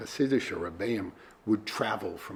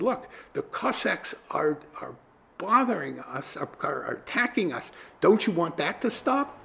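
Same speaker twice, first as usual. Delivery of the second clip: higher, louder pleading with the innkeeper; 7 semitones higher, 4 dB louder than usual. Retold or imitated. Ohev Yisrael pleads with the innkeeper to stop Cossacks, pleading. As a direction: beg him to understand. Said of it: "And in pleading".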